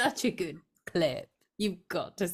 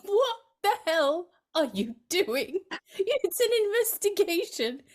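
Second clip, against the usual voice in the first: mock exasperated; 11 semitones higher, 3 dB louder than usual. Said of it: "And you just think".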